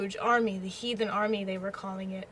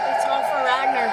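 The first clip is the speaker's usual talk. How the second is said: excited cheer; 3 semitones higher, 8 dB louder than usual. Real, not acted. Cheering for the character.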